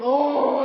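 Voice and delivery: loud, drawn-out zombie groan; imitated zombie moan. Zombies heard in the distance, loud imitated moaning. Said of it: "there's, like, zombies, and we can hear them".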